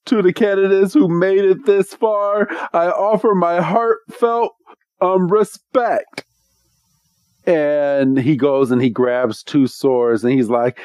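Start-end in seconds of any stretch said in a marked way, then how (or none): 0.1-6.2 higher, grandly formal proclamation; Wickstrom, solemn host. Retold or imitated. Trial master honouring candidates, mock-solemn proclamation. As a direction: play a ceremonious trial master.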